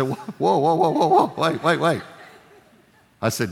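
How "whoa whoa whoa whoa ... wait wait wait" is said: louder, higher, laughing whoas; excited whoa. Retold or imitated. Husband stopping wife after she hears something, laughing and excited. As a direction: stop her, thrilled and laughing.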